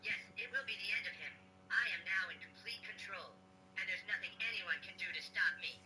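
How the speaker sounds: ordinary talk, nothing marked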